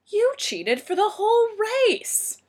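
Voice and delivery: high, loud, drawn-out indignant accusation; scandalized high exclamation; shocked outrage. A narrator's reading. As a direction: cry out in disbelief, stretching the words. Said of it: "Her jaw dropped".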